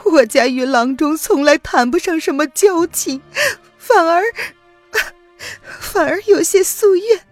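high, loud, choked pleading; humble yourself through tears; desperate tearful plea. Acted. Woman humbly begging old rival physician, high and tearful.

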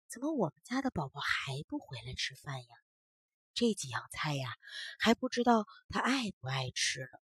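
low soft muttering to herself; grumbling mutter. Acted. Grandma mutters while waiting for her grandson, low and soft. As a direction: mutter under your breath, worried. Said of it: "嘴里还不停地嘀咕着".

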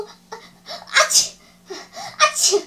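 sharp, high "achoo" sneezes; high, explosive mock sneezes; two acted sneezes. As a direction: gasp in, then sneeze twice. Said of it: "他又打了两个喷嚏".

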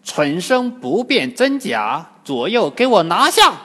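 imperious shouted order; bellowed command. A narrator's reading. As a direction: order the seizure with building force. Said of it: "正中的菩萨开口喝道".

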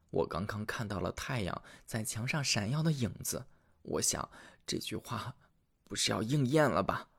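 soft, fearful tailor confessing; a lightly voiced guilty husband; hushed confession. Tailor confesses to his wife.

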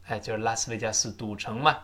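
ordinary talk

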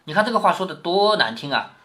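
ordinary talk

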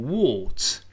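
British "what" with a rounded, closer vowel; drawn-out British "what"; British pronunciation. Shown as a demonstration. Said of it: "英式发音读成what".

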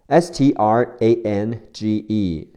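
ordinary talk, nothing marked

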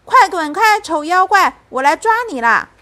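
put-on high, loud child's war cry; bold, excited threat at full voice; shouting. A narrator's reading. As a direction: yell bravely to chase it away. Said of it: "我也喊道".

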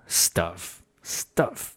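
"stuff" soft, with a short uh; quiet, separate "stuff" with uh as in cut; correct short vowel. Shown as a demonstration. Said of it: "需要注意的是".

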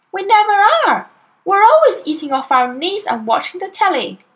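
put-on high child voice, shocked and reproachful; a little girl scolding her cheating father; indignant young Matilda. A narrator's reading. Matilda protests.

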